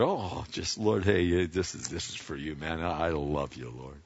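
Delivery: soft, heartfelt thanks to the Lord; joyful giver's prayer. Acted. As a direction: pray it gently, like a grateful friend. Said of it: "that's the attitude it's supposed to be".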